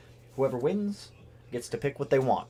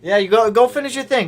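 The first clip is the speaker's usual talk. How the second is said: playful call; 10 semitones higher, 10 dB louder than usual. Real, not acted. Shooing friend back to work.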